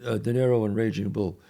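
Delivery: normal talking voice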